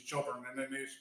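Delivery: normal talking voice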